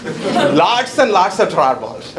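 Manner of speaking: lots and lots of stretched out slowly; slow, drawn-out emphasis. Shown as a demonstration. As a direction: lean on lots and lots, laughing. Repeats for emphasis.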